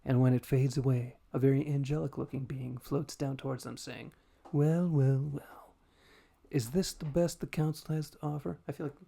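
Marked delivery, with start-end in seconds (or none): 4.5-8.6 snooty voice. Acted. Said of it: "I wanna do, like, a fucking snooty voice".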